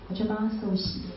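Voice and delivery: ordinary talk, nothing marked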